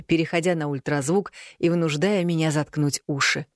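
plain reading voice

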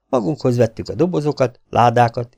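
ordinary delivery, nothing marked

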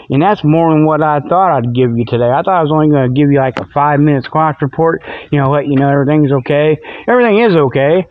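ordinary talk, nothing marked

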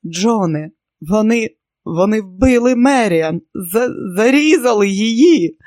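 loud, high broken crying words; sobbing. Acted. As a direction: sob it out uncontrollably. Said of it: "Тоні нестримно плакав".

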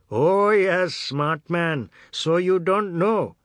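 loud, high, biting mockery; sarcastic anger. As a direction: sneer it, slow and furious. Bandat sarcastic and angry.

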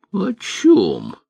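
put-on high creaky old voice. A narrator's reading. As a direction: creak it out like a rusty hinge. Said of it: "проскрипел Богольд".

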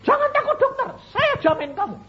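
high-pitched, loud reassurance; imitated high, excited yell; acted rescuer's shout. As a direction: call out urgently as you run to help. Acts out rescuer running up.

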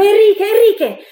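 sudden high, loud call; cry out. A narrator's reading. Girl spots Enrique rounding the corner, crying out. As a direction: let the name escape as a shout. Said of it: "mi scappò un grido".

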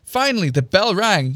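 plain reading voice

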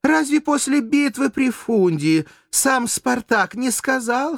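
high, insistent rhetorical challenge; impassioned, driving accusation; heated argument. A narrator's reading. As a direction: press the case with indignation. Courtesan argues her accusation heatedly.

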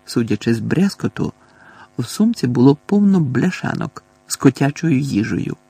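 ordinary delivery

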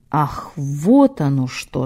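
slow, drawn-out sinister menace; slow theatrical villain menace. Acted. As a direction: drawl it out, low and menacing. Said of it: "зловеще протянул Митрофаний".

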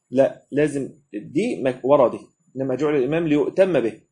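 ordinary talk

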